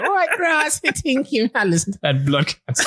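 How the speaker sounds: high, laughing mock voice of the ex; teasing impression of his ex reacting to the show, high and laughing; imitating the ex. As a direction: pitch way up and giggle through it like the ex. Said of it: "She's like".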